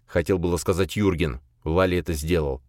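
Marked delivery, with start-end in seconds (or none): none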